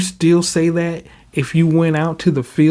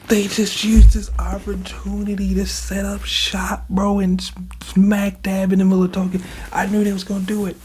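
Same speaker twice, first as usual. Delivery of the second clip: raised mock rant; 6 semitones higher than usual.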